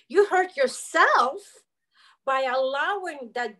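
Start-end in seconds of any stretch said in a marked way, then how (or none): 0.1-1.6 You slow, louder and higher on You; stress on YOU. Retold or imitated. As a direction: land the blame on You, slowly. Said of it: "therapist says".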